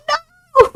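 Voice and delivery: very high drawn-out "no"; mock wail. Streamer dismayed at another ad, mock wail.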